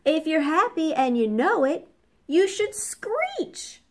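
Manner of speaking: high, spoken storybook shout; parrot's excited call. A narrator's reading. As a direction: shout it high, stretching to a peak on the last word. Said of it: "shouted the parrot".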